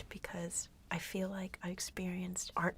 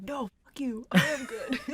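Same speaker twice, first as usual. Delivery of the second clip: louder, higher mirror argument, laughing; mock defiance; 5 semitones higher, 9 dB louder than usual. Co-host imitating his mirror argument, mock defiance. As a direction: talk back to yourself, hammed-up and amused.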